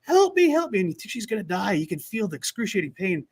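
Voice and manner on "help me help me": high pained pleading help me; retold collapse cry. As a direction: cry out like a runner in pain. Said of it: "then she collapses".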